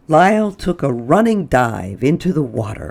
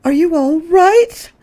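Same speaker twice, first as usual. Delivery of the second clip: high, louder alarmed cry; 5 dB louder than usual. Acted. Mrs Prim cries out to her fallen guest, high and alarmed. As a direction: call out in alarm after the fall. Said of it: "Missus Prim cried out".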